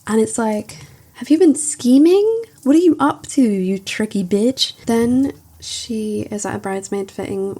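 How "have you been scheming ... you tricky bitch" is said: a hammed-up jab, high and loud, slowing at the end; mock suspicious accusation. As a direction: playfully accuse her of scheming. Retold suspicious accusing quote.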